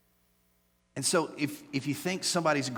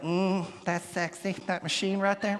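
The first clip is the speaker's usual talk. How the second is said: mock sultry; 4 semitones higher than usual. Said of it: "do you think my wife's like".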